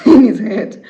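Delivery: real laugh breaking into narration; amused laughing. Real, not acted. Narrator amused telling the groom's death, laughing. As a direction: laugh through the words. Teller laughs at own phrasing.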